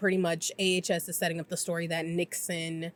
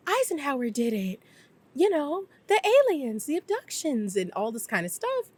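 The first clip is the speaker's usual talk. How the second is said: higher, lightly voiced dreamy starlet; breathy Marilyn voice; 6 semitones higher than usual. Retelling Marilyn in the pool, a higher, dreamy mock starlet voice. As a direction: coo it like a movie star lost in thought. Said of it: "she's like".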